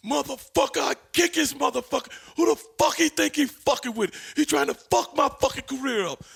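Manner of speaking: imitating Randy Savage. Retelling Savage's backstage rant, high loud impression. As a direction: go full Macho Man fury, high and loud. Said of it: "Randy Savage".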